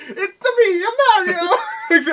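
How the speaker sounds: high, loud laughing Mario. Pretend. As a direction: squeal it like the plumber. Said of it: "all I can think about is".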